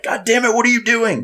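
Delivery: put-on dad yelling at the girls at home; imitated yelling. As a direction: bellow like the loud dad of the house. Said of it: "I'm like".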